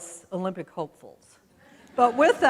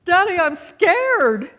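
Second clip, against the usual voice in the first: put-on scared child; 9 semitones higher, 7 dB louder, slower than usual. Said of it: "and I said".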